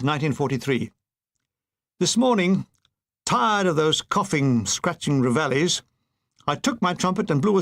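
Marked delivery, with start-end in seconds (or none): none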